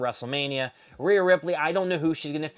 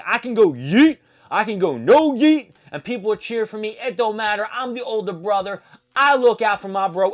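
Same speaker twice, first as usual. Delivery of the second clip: loud, higher-pitched imitated wrestler boast; boastful retort; 7 semitones higher, 8 dB louder than usual. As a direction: play the older brother bragging at a rival, raised voice. Acted wrestler brag in quarrel.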